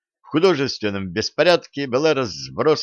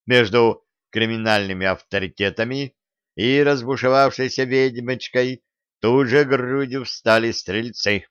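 plain reading voice

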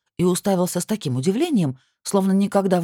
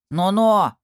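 a warning "Но-но!" pushed out louder; threatening; 2 semitones higher, 5 dB louder than usual. A narrator's reading. A small beast warning off an intruder, loudly threatening. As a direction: warn him off, firm and loud. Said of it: "с угрозой сказал".

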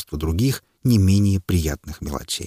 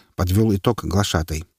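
usual speaking voice

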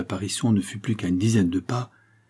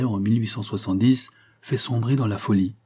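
plain reading voice

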